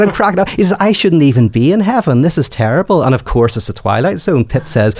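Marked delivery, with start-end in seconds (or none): 0.0-3.0 higher, pleading despair; beg, falling apart; a touch of a man at his wits' end; distraught Rocky. Retold or imitated. Rocky despairing about heaven.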